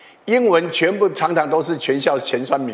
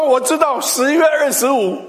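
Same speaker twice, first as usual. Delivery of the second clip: proud daughter; 8 semitones higher than usual. Retold or imitated. Daughter proudly gives wrong answer.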